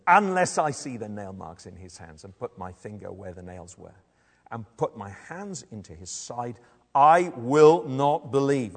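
firm, angry refusal building to a loud finish; angry disbelief. Acted. Thomas refusing to believe the other disciples, angry. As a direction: dig in, slowing hard on the last words. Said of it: "Hear the anger in his voice".

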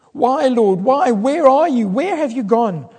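high, loud anguished calling out; desperate cry. Acted. Preacher voicing David's anguished cry to the Lord. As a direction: call out to a God who seems gone. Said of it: "It feels to David like that the Lord is forgotten".

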